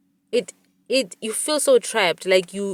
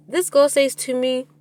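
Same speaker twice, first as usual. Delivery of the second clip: usual speaking voice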